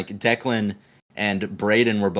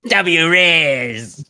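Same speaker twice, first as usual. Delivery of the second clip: high, loud, drawn-out catchphrase; Ian; 6 semitones higher, 6 dB louder than usual. Retold or imitated. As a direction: imitate a friend's goofy loud call. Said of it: "he turns to us and goes".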